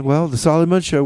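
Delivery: normal talking voice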